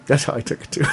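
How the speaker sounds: That's how I took it too through a laugh. Real, not acted. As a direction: chuckle the line.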